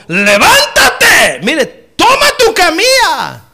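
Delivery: high, slow, loud voice of Jesus giving a command. Acted. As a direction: boom it out slowly like Jesus raising the lame.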